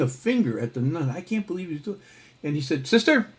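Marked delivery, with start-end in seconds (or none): 2.9-3.3 a high, loud "Sister" like a lawyer opening his case; high, loud appeal. Retold or imitated. Johnny makes his big appeal to the nun: high, loud "Sister". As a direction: call "Sister" up high and loud, full of confidence.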